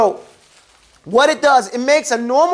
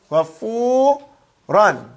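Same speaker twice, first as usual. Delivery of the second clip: GHAFURAN very slow, long held "fuu", then "ran" apart; slow breakdown; 2 semitones lower, much slower than usual. Shown as a demonstration. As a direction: stretch and split GHAFURAN for learners. Repeats word broken down slowly.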